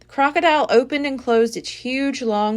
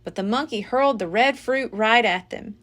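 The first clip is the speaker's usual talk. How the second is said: ordinary delivery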